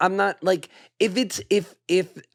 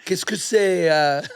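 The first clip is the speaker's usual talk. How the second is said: hammed-up French accent, higher; 4 semitones higher than usual. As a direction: play a cartoon Frenchman. Mock Frenchman greeting.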